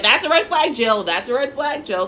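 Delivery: high, loud mock warning; scolding friend impression. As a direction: drill it in like a nagging friend. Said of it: "was that your impression of my voice".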